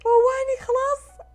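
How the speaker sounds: half-crying plea to be left alone; terrified plea. Retold or imitated. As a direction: beg the chaser to stop. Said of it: "أنا قلت".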